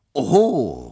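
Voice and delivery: loud, high surprised oho; exclaimed. Acted. Lawyer exclaiming at Nippers' changed opinion, loud surprise. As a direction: call it out, caught off guard. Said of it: "rief ich".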